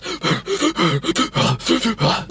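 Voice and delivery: rapid hyperventilating gasps; mock losing-it panting; exaggerated panicked gasping. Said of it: "the bird fucking loses it, and he starts".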